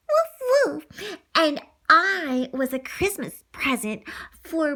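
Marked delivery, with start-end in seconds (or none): 0.1-1.2 high yappy barking woof; puppy woof. Pretend. Child as Lady the dog barking high. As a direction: bark like a little spaniel. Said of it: "So, I'm Lady".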